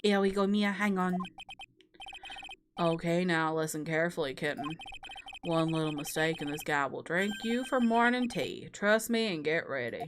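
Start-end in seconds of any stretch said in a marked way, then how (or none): 2.8-10.0 low, softer teasing rival voice; cocky prosecutor. Acted. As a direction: purr the taunt down low. Rival prosecutor taunts Mia.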